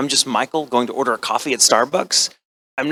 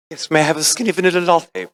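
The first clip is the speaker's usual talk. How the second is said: put-on British accent, pitched higher; 5 semitones higher than usual. Shown as a demonstration. Actor shows his Starbucks order accent, British. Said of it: "So that is one of my accents".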